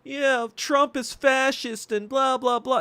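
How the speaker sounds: high, drawn-out mock whine of protest; mocking Democrats. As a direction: imitate complaining Democrats in a slow, high-pitched voice. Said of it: "Democrats being like".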